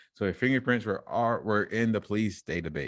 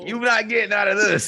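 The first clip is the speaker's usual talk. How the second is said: mock menacing voice; 12 semitones higher, 7 dB louder than usual. Mock threat in put-on voice.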